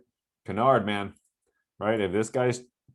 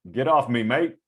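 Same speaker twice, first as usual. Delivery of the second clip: badly faked Australian accent, pitched up at the end; terrible Australian accent; 4 semitones higher, 4 dB louder than usual. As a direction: put on a bad Aussie accent. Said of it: "That's a terrible accent".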